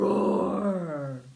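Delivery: big loud roar; dragon roar. Pretend. Parent leads child in a loud dragon roar.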